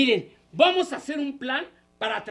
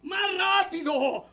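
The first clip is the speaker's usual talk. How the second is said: high, drawn-out demand; urging call; 4 semitones higher, slower than usual. Acted. Host urging children to warn sooner, high slow call. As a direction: stretch it out, calling for speed.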